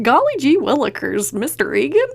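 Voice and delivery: breezy and cheerful, all is well; hunky-dory. Retold or imitated. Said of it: "It's just very hunky-dory".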